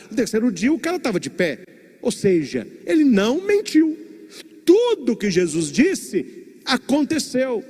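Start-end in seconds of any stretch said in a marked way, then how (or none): 2.9-7.6 fervent. Real, not acted. Preacher stresses Jesus kept his word.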